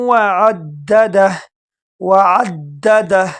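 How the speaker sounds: وعددہ with a jolt on the doubled دال; doubled dal. Shown as a demonstration. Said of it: "دال کو جھٹکے کے ساتھ پڑھیں گے".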